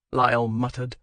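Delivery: ordinary delivery, nothing marked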